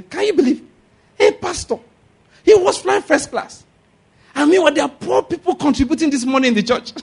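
high, loud scandalized gossip voice; mocking gossip. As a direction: squeal it like a shocked busybody. Judases mocking pastor's first class.

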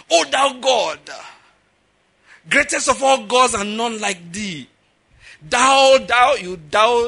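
slow, loud, high theatrical invocation; mock grandiose prayer. Showing how not to pray, loud drawn-out mock invocation. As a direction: intone it pompously, stretching each word. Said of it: "your prayer shouldn't be".

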